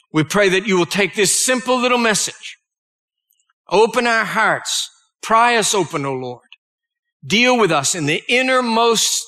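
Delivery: preacher praying over his flock; prayer. Acted. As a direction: pray for the congregation, lifting toward God. Leads congregation in prayer.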